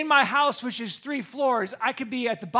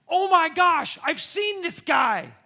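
alarmed realisation; 8 semitones higher, 8 dB louder, slower than usual. Retold or imitated. Sudden realisation of the stalker.

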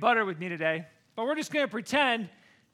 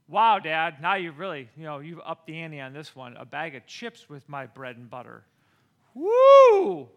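sarcastic cheer; 6 semitones lower, 6 dB louder than usual. Retold or imitated. Sarcastic thanks dad.

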